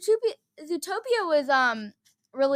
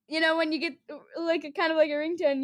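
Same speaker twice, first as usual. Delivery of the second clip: ordinary talk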